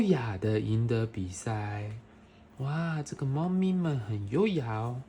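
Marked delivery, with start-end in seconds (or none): none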